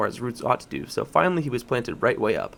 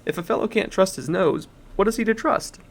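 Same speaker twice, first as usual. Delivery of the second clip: higher, louder huffy protest; indignant bulldog; 8 semitones higher, 4 dB louder than usual. A narrator's reading. Bulldog defends his nose to the elephant, indignant. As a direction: bristle with offended pride. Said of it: "said the bulldog".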